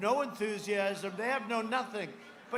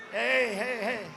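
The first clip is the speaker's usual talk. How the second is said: loud, raised mock-angry heckle back; mock agitated; 3 semitones higher, 6 dB louder, slower than usual. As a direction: imitate an agitated man hushing the crowd loudly. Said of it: "he's very agitated. You know how angry he is?".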